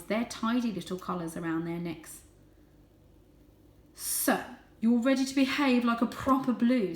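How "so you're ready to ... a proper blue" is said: louder, higher fatherly voice; a father judging his son; stern father. A narrator's reading. Father cat talks down to Varjak, stern. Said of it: "said Father".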